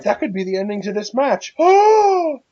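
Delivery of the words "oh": loud, high, drawn-out gasp; dramatic gasp. Real, not acted. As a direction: gasp as if shocked. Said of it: "Triple gasp".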